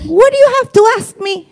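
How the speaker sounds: mother's voice. Retold or imitated. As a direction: ask it as a puzzled mom. (voz de madre intrigada, mucho más aguda)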